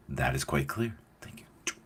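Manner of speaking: soft, low, measured reply; understated, cool retort; quiet dismissal. Retold or imitated. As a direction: say it quietly and evenly, dropping to a whispered thanks. Acts cool reply to angry daughter.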